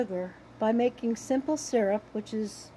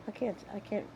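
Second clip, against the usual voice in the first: ordinary talk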